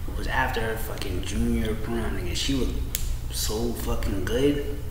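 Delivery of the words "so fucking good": ordinary talk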